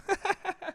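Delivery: high, soft giggle; real laugh. Real, not acted. Speaker admits being bad at shots, high soft laugh. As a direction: laugh quietly at your own confession. Laughs at his own confession.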